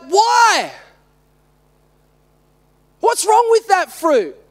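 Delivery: high, drawn-out complaining "Why"; childlike protest. Retold or imitated. Preacher questioning God about his cousins, childlike protest. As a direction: demand an answer like a frustrated kid. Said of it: "sometimes I feel like my little son".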